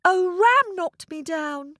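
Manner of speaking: put-on high-pitched child voice; upset little boy. Boy tells the cow what happened, upset and high. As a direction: complain as a small hurt boy. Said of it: "The boy told the cow".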